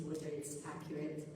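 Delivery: ordinary delivery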